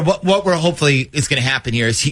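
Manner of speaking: ordinary talk, nothing marked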